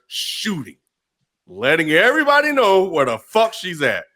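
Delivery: ordinary delivery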